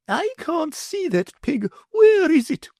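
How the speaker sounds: peer for the pig, fretting; fussy lady. A narrator's reading. Mother's voice held.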